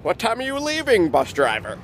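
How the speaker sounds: high, chirpy, proper-sounding voice. Retold or imitated. Polite rider chirping at the bus driver, mock proper voice.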